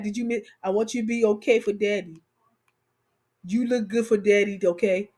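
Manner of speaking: lightly voiced man, pitched low; partner's voice. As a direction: imitate her man's low sweet talk. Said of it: "He be like".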